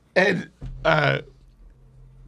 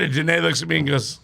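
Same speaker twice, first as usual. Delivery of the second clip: ordinary delivery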